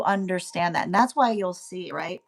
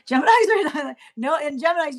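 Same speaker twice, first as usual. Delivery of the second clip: laughing through the words, higher; 6 semitones higher, 5 dB louder, faster than usual. Real, not acted. Astrologer cracking up about Geminis, laughing. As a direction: giggle as you say it.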